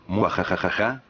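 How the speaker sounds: evil villain cackle; gleeful sinister laugh; mustache twirling villain. Acted. Storyteller's evil laugh before horror story.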